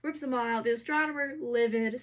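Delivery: ordinary delivery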